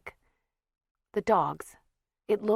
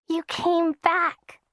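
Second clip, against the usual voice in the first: put-on high, slow small child's voice; a sleepy four-year-old; little girl; 12 semitones higher, 5 dB louder, much slower than usual. Four-year-old Margaret speaks.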